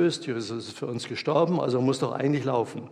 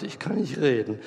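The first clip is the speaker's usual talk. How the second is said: usual speaking voice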